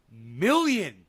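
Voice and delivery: million hit loud; stressed million. Acted. Streamer exaggerating the number of responses, loud punch. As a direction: punch the word million big.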